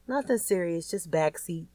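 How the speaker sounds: ordinary delivery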